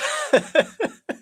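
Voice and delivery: high burst of laughter. Real, not acted. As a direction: laugh out loud. Laughs at chat.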